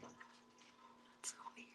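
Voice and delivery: hushed whisper to herself. Real, not acted. Whispering to herself at the cat bowl. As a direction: breathe it almost silently.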